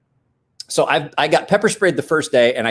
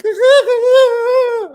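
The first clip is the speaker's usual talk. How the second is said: pained wail; 6 dB louder than usual. Retold or imitated. Jock reacting to pepper spray.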